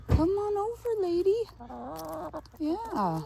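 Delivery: very high coaxing sing-song; pet baby talk. Acted. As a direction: call the hens over sweetly and brightly. Coaxes hens over.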